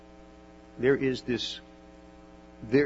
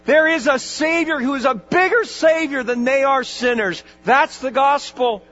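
louder, higher, rising fervour; full-force preaching; 10 semitones higher, 8 dB louder than usual. Acted. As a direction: drive the gospel home to the congregation.

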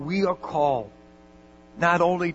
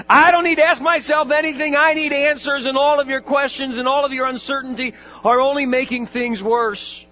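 loud, high, fast and furious; angry rant; 11 semitones higher, 8 dB louder than usual. Retold or imitated. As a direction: lash out at her uncertainty. Dying man rails at chaplain.

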